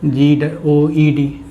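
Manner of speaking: जी ओ ई डी spelled out slowly, one letter at a time; जी ओ ई डी read letter by letter as a wrong spelling. Shown as a demonstration. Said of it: "अब अगर हम इसको लिखें".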